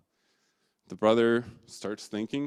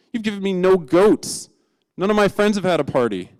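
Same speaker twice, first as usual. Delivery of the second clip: higher and louder, bitter ranting; furious outburst; 6 semitones higher, 8 dB louder than usual. Acted. Older son ripping into his father, furious and loud. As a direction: rip into him with hurt fury. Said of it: "his older son just rips into his dad".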